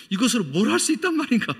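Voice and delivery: high, loud, fast doubting question; quick incredulous wondering; doubtful question. Retold or imitated. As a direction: doubt it aloud, baffled. Philip's doubting thought.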